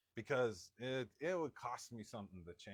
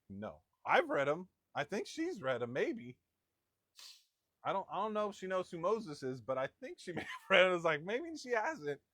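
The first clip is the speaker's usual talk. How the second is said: higher, wavering mock uncertainty; mock defensive doubt; 6 semitones higher, 4 dB louder than usual. Husband asked about reading verses with his wife, comically unsure. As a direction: play a defensive husband getting less sure as he goes.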